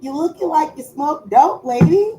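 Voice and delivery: high, rising mock-worried questions; teasing. Real, not acted. Roasting her staring daughter, playful mock concern. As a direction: needle her with fake concern.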